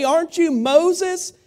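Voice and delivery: high, drawn-out call of a mock-friendly Bush; a hint of George W. Bush greeting Moses; hearty question. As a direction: stretch it out like a hopeful fan. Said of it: "he said".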